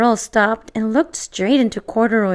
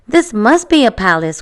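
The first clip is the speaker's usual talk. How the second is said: usual speaking voice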